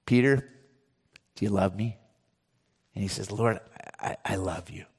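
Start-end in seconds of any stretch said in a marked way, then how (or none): 0.1-2.0 tender question. Acted. Jesus gently asking Peter by the fire, hushed and tender. 3.3-4.9 much softer and lower, almost breathed; hushed reply. Acted. Peter humbly answering Jesus by the shore, hushed and low.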